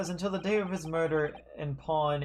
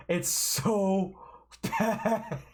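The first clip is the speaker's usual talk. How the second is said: higher, breaking into a laugh; real laughing dismay; 6 semitones higher, much slower than usual. Real, not acted. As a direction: laugh helplessly through the words. Laughing at own bad voice.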